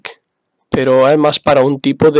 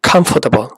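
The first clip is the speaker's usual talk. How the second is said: COMfortable, first syllable stressed, the rest reduced. Shown as a demonstration. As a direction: hit the "com" of "comfortable", let the rest fall. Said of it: "en este caso va en la primera".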